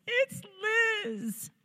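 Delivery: mock-sob it, voice squeezed high then falling away; retold weeping. Weeping daughter correcting her dad on the phone, acted crying. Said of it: "and so I'm weeping".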